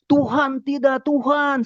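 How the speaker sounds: high, loud plea to God; imitated pleading yell; retold shouting. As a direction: re-enact crying out in protest, pitch way up. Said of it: "saya teriak-teriak".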